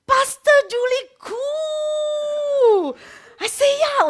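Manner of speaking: very high, loud drawn-out call; ecstatic exclaim. Retold or imitated. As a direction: cry the name out in joy. Retold name called out.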